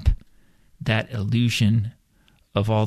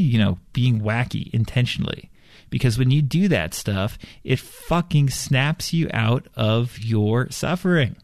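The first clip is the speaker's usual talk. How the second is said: usual speaking voice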